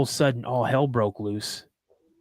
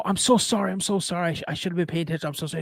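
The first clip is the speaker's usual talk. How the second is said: flustered apology; 5 semitones higher, much faster than usual. Retold or imitated. Retelling his apology for bumping into someone, flustered. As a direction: act out a repeated, embarrassed apology.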